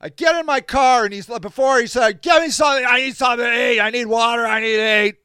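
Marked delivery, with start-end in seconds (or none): 2.2-5.2 strained, demanding whine, imitating a drunk; mock drunk wailing. Drunk man begging his helper for food, mock wailing. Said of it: "yelling and crying simultaneously".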